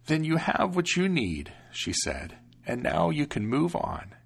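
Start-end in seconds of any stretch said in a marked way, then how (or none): 0.1-1.5 protective grandmother, unhurried; old grandmother. Acted. Grandmother sends hero off. 2.6-4.1 protective grandmother, unhurried; old grandmother. Acted. Grandmother sends hero off.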